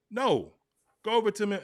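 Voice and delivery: normal talking voice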